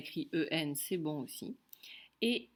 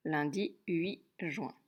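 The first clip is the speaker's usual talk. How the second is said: slow, deliberate repeat; dictation repeat; slower than usual. Shown as a demonstration. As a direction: say it again slowly for writing. (répétition lente, bien détachée)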